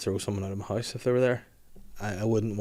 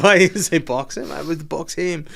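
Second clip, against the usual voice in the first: higher, louder disbelieving question; incredulous; 7 semitones higher, 7 dB louder than usual. Retold or imitated. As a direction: ask it in disbelief. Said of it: "And I was like".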